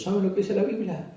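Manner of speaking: usual speaking voice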